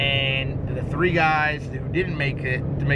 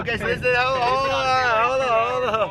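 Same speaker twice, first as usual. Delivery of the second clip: urgent protest; 10 semitones higher, 5 dB louder than usual. Real, not acted. Host stops his friend betting too early, urgent protest. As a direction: cut in to halt them, pitch shooting up. Cuts off crew's early bet.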